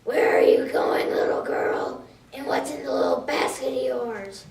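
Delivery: sneak it out like a sly wolf; wolf questioning the little girl, rough and hushed. Acted. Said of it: "in a rough voice".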